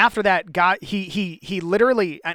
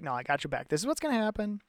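calm God; 2 semitones higher, 10 dB quieter, much faster than usual. Retold or imitated. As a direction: settle him down gently, quietly. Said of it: "God's like".

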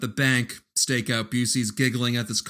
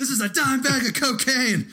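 coked-up cop; 12 semitones higher than usual. Retold or imitated. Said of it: "continues the bit".

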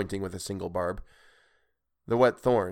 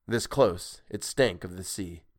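plain reading voice

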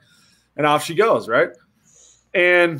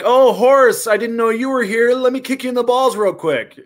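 put-on light, higher sweet girl voice; chirpy mock blonde girl; girly playmate voice; 7 semitones higher, 4 dB louder than usual. Storyteller voicing the playmate offering a kick, sweetly.

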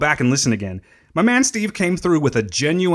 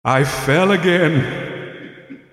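very slow, echoing ghost; drawn-out spectral wail, breaking into laughter; ghost with vocal effect; much slower than usual. Pretend. Podcaster as dead friend confesses he fell, slow spooky ghost voice. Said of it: "sick of this vocal effect today".

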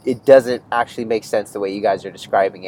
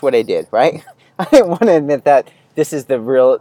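normal talking voice

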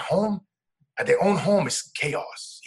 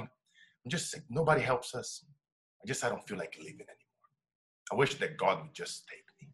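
quiet, low, worn-down voice; retold despairing wife; 5 semitones lower, 8 dB quieter than usual. Husband retells his wife's hopeless confession, low and subdued. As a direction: let the words sag softly, imitating someone giving up. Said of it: "And then she goes and tell me".

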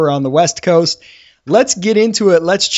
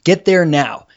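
ordinary talk

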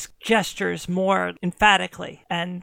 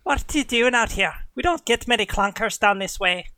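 quick, higher Irish brogue; Irish huntress; 3 semitones higher than usual. Acted. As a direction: question the strangers in a brisk Irish lilt. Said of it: "slipping into Irish now".

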